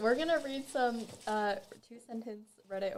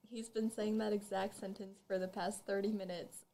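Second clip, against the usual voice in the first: ordinary delivery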